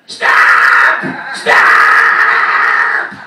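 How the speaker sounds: roaring stop, stop at full blast; huge shout. Retold or imitated. Rock star stopping the song with a loud shout. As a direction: yell it to cut the music dead. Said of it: "opened his mouth so loud when he said".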